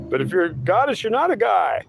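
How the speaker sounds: high, pleading whine of a lost believer; whiny seeker voice. Acted. Seeker whining back at his God, high and whiny. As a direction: whine it up high like a fed-up crybaby.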